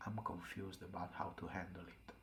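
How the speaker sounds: sober, subdued admission. A narrator's reading. Mike replying to Will after taking his time, quietly serious. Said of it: "his voice is more serious".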